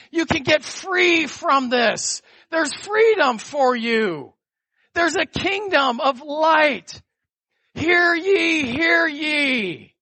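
high, slow herald proclamation; grand rich voice. Acted. Proclaiming message to addicts.

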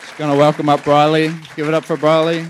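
loud, high call to welcome the next speaker; rally the room for Briley, loud and up; hyped welcome. Real, not acted. Host calls up next speaker.

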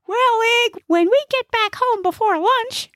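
very high comic character voice; high-pitched little know-it-all; chatty Gnesi. Acted. Gnesi makes conversation while driving, high chatty voice.

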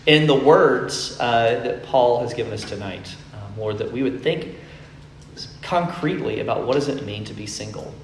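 soft, low opening prayer. Real, not acted. Pastor praying over the congregation, soft and low. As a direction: pray quietly before the sermon.

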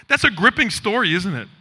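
normal talking voice

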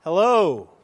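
put-on boy calling into the echo valley. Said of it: "called out".

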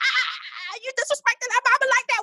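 high, fast indignant yell; mock outraged scolding. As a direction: tell him off for disrespecting mom. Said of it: "we'd be like".

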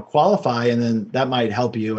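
usual speaking voice

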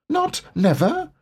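small, high, timid voice; a tiny anxious piglet asking. A narrator's reading. Narrator reads Piglet to listeners, small and high.

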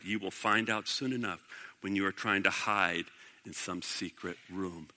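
low, hushed, ominous reply; quiet foreboding. A narrator's reading. Micaiah warns the man who slapped him, quietly ominous. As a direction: drop low and soft, letting it fade away.

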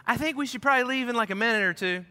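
imitating mom, high, fast and breezy; mock-casual about a house fire; super chill mom. Son imitating his unbothered mom, high and breezy. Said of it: "Like, just super chill all the time".

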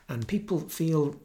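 ordinary delivery

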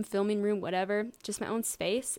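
normal talking voice

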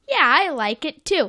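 a hint of an eager cartoon character; lightly voiced Sandy. Sandy agreeing with SpongeBob about the show, lightly voiced. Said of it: "Then Sandy is like".

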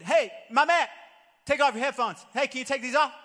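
calling out loudly. Retold or imitated. Friend calls to a big man at the gym, loud. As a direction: get his attention across the gym. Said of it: "tell this dude".

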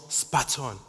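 ordinary talk

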